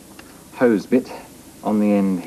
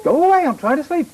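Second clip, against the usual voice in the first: much higher hammed-up angry shooing; playfully furious at the cat; mock angry puppet. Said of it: "Getting very angry now".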